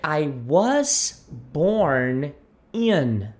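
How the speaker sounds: very slow "I was born in."; linking "I was born in." slowly; model pronunciation. Shown as a demonstration. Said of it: "você vai dizer". ("I was born in." bem devagar)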